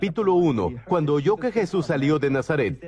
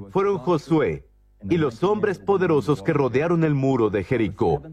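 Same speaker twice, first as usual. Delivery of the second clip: ordinary delivery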